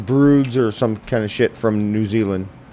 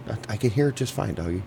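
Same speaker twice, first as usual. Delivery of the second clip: talking quietly; 9 dB quieter, faster than usual. Real, not acted. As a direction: keep your voice down, speaking fast. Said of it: "Why are you talking so quiet?".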